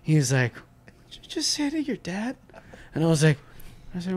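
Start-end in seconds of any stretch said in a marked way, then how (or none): 1.1-2.4 high, soft-voiced indignant challenge; affronted dad. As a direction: play a dad who can't believe it. Acted offended dad answering son.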